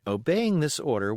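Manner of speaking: ordinary delivery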